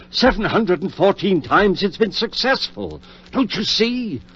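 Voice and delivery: carried away by his own success; excited insistence. Acted. Surgeon proving his record to his doubting friend, excitedly.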